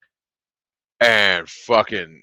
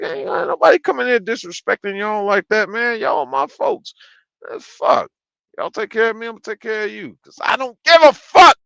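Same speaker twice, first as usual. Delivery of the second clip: high-pitched, heated loyalty speech; impassioned, raised-voice vow; retold fired-up defence; 13 semitones higher, 7 dB louder than usual. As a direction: re-enact standing up for your folks. Retelling own defence of the staff.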